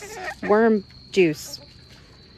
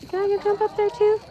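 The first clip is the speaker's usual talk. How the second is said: much higher, eager little pet voice; voicing the pet; 13 semitones higher, 3 dB louder than usual. Owner voicing her other pet, eager and much higher. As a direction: squeak it out like a begging little animal. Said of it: "She's like".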